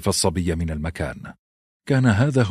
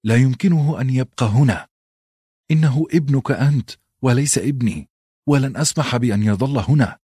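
agitated wife cutting in, pitch raised; tense, higher-pitched narrated objection; edgy, irritated; 6 semitones higher than usual. A narrator's reading. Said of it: "قاطعته كاتلين بعصبية".

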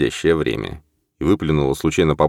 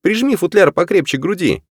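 shouting. Acted. Norman shouts in the river.

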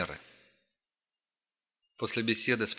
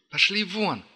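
yelling; 10 semitones higher, 5 dB louder, slower than usual. Acted. Standoff, yelling at bandits.

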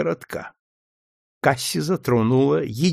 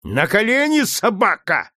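high, louder yell; shouted command; 10 semitones higher, 4 dB louder than usual. A narrator's reading. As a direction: shout him down to his knees. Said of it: "закричал Легри".

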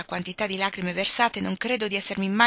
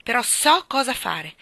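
beaming; 4 semitones higher, 5 dB louder than usual. Acted. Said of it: "annunciò raggiante".

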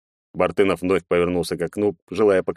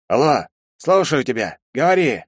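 high voice over the phone; as if through a phone speaker, raised; голос из трубки; 7 semitones higher than usual. A narrator's reading. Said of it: "раздался с трубки голос". (голос в телефонной трубке)

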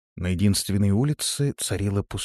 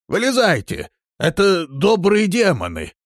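high, loud summons; calling out; 11 semitones higher, 5 dB louder than usual. A narrator's reading. Villager urging his family out of the cellar, calling out. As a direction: call down to the others below.

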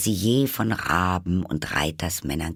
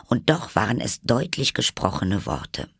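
ordinary delivery, nothing marked

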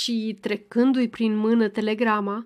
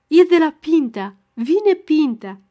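higher and much louder, slowing on vine; joyful outburst; 5 semitones higher, 7 dB louder than usual. A narrator's reading. Ghighi announcing the telegram to the family, joyful outburst. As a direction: burst out with the good news. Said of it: "cum izbucni voioasă".

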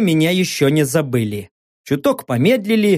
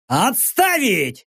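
slow, raised military bark; barked command; 4 semitones higher, much slower than usual. A narrator's reading. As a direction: bark the order, stretching it out. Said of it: "рявкнул я".

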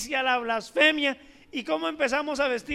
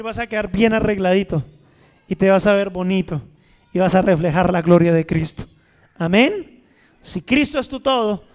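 loud, low, booming exhortation; fervent preaching; 5 semitones lower, 11 dB louder than usual. Acted. Pastor exhorting the church to put on Christ, booming. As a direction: thunder the promise to the people.

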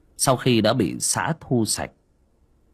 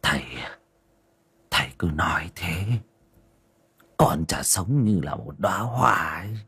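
feeble whisper. Acted. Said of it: "thều thào".

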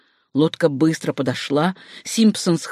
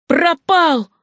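high exclamation of loss; sharp high outcry; dismayed cry; 10 semitones higher, 3 dB louder than usual. A narrator's reading. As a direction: cry out high as the prize is lost. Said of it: "вскрикнул Симпсон".

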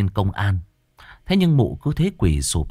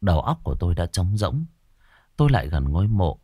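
plain reading voice

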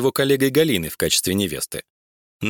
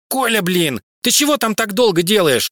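annoyed wife calling; 11 semitones higher, 4 dB louder than usual. Acted. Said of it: "стучалась к нему жена".

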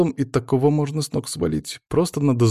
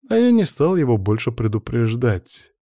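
ordinary delivery, nothing marked

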